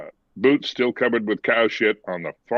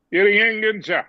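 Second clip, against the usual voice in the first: higher, mumbled thick Irish brogue; thick rural Irish; 11 semitones higher than usual. Retold or imitated. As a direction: mimic an unintelligible old farmer. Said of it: "I can't understand what you're saying".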